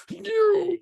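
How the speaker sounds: a pretend outburst at a German stranger; mock accusing yell. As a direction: point and yell it in mock outrage. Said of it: "I wouldn't be like".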